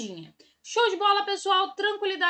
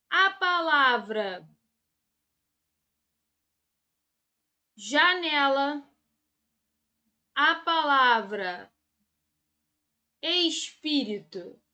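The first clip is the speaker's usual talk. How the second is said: drawn-out words with long pauses; slow dictation; 3 semitones lower, much slower than usual. Shown as a demonstration. Teacher writing example words for the class, slow dictation. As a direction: slowly spell out each word as if writing it.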